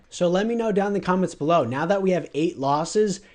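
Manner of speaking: ordinary talk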